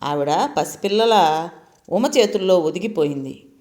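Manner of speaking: plain reading voice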